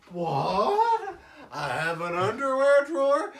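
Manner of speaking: clueless husband half-laughing; laughing disbelief. Retold or imitated. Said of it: "And he's like".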